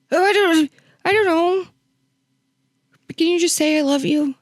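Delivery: high, caught-off-guard fluster. Retold or imitated. To the confused mom, flustered and pleading. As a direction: stammer like you're thrown off.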